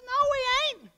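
very high, loud mock wife voice; wife refusing. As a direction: squeal the wife's flat refusal. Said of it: "She says".

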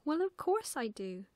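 high, loud, astonished assertion; surprised little girl. A narrator's reading. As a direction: state the obvious in amazement. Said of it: "looked at Plop in surprise".

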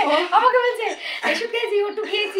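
laughing. Real, not acted. Retelling while laughing.

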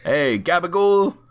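Hey gabagool, high and loud Italian-American gangster; hammed-up mobster greeting, gabagool; mock gangster accent. Shown as a demonstration. A host shows a gangster voice, mock Italian-American accent. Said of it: "And then you've got a gangster".

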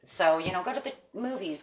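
ordinary delivery

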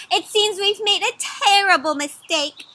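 urgent near-shout about the mistake; nearly shouting. A narrator's reading. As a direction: call it out high, close to shouting. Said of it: "she nearly shouted".

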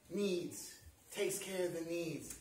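soft, low winding down; hushed reassurance. Real, not acted. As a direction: let it settle quietly after the climax. Promise winds down after climax.

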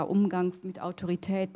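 usual speaking voice

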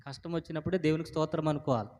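normal talking voice

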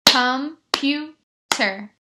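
"Computer" very slow in three separate beats, COM, PU, TER; slow syllables. Shown as a demonstration. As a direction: clap out "Computer" with a pause after each syllable. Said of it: "use my hands to clap".

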